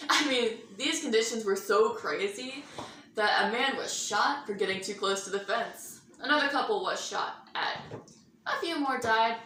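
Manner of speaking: ordinary delivery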